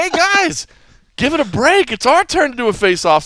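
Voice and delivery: high-pitched retold call for attention; imitated calling out. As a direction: mimic someone breaking up a squabble. Retold fighter calling to bickering camps.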